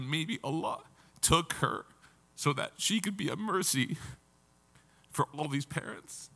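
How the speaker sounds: tearful and breaking, pitch up, fading out at the end; holding back tears through the words. Real, not acted. Quoting the doctor to the congregation, voice breaking. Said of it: "it gets me".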